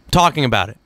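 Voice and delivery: usual speaking voice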